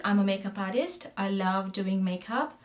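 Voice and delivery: usual speaking voice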